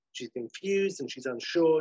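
normal talking voice

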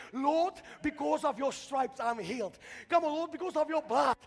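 high, loud, ringing declaration; full-force preaching. Real, not acted. Preacher declares the cross is finished, full-force preaching.

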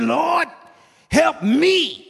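high, loud cry for help; shouted plea. Acted. Woman crying out to the Lord in a loud shout. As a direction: cry it out at the top of the whoop.

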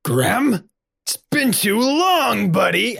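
high, delighted reunion cry; joyful greeting. Acted. Man bat creature overjoyed to see Graham again. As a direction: greet an old buddy with glee.

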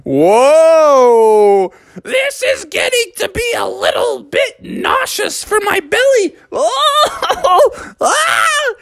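high falsetto wail of nausea; queasy Mother. Acted. Mother getting sick on the Ferris wheel, high queasy wail. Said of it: "And Mother was like".

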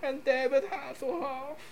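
very high, slow put-on voice. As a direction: gush like a fake fan club, drawn out. Mock German fans praise [name].

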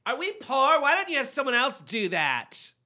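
put-on high, loud teen whine; spoiled daughter mocking her dad; bratty daughter.

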